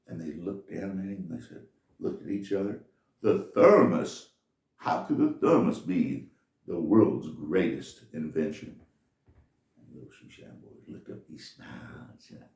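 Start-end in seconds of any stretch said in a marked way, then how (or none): 3.2-8.8 loud, slow mocking disbelief; scoffing rich man. Rich men scoff at the shoe shine boy, loud and slow. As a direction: scoff at the boy's answer.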